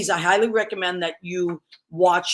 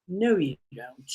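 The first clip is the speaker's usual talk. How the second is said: put-on sassy kid; 4 semitones lower, 4 dB quieter, slower than usual. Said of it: "she was a little sassy".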